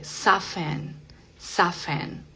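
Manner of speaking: "soften" said softly as "soffen"; no T at all in "soften"; silent T. Shown as a demonstration. Teacher showing learners the word, silent T. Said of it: "nada de T".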